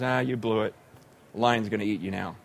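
low, casual mock verdict; offhand verdict. Prophet telling the man of God he is doomed, low and offhand. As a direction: toss off the bad news low and quick.

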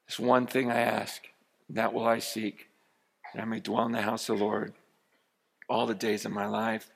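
low, soft, intimate preaching; hushed sermon. Acted. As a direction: draw them in close and quiet. Quiet intimate preaching again.

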